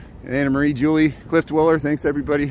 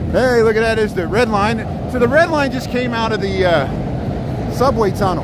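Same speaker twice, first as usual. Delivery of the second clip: excited; 6 semitones higher, 3 dB louder than usual. Real, not acted. Spots the train, excited.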